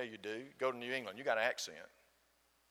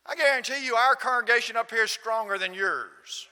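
raised voice, pressing the claim; loud retold challenge; 8 semitones higher, 10 dB louder than usual. Gentleman challenging the visiting preacher about congregations, loud retold boast. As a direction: imitate an emphatic boast, high and loud.

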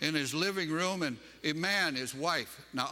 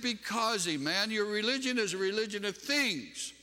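high-pitched, emphatic retold answer; impassioned retort; 7 semitones higher than usual. Preacher retelling his answer to sobbing friend, impassioned retort. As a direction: declare it with preacher's conviction. Acted retort in religious argument.

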